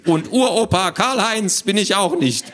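high, self-important little man; squawky know-it-all. Acted. Little man introduces himself to children, squawky. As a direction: announce it in a fussy squawk. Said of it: "eine quäkende Stimme".

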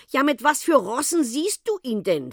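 high, loud, exasperated question; impatient. A narrator's reading. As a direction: demand an answer impatiently. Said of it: "fragte Frau Philipp ungeduldig".

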